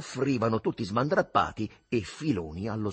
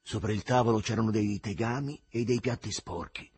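plain reading voice

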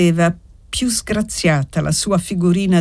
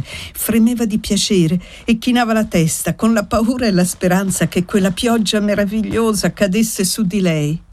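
ordinary delivery

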